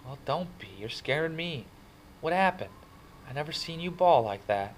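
high, anxious questioning; worried. A narrator's reading. Friend asking crying kid what happened, worried. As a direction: fret over your crying friend.

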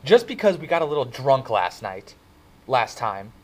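put-on Soc boyfriend's voice. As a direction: brush it off, raising his voice a little. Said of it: "The Soc's voice broke into my thoughts".

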